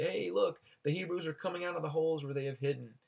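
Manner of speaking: high, mocking jeer at the Hebrews; sarcastic taunt, lightly acted. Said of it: "the sarcasm in this".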